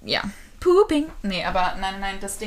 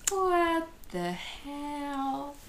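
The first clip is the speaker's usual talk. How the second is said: what the hell; 6 semitones higher, 5 dB quieter, much slower than usual. Shown as a demonstration. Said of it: "This is what I say".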